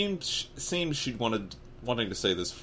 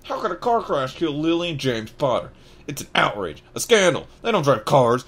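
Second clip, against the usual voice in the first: indignant outburst, peaking louder and higher; angry outrage; 7 semitones higher, 8 dB louder than usual. Acted. Outraged at the car crash lie, angry. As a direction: protest it as a scandal. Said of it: "jumping up so angrily".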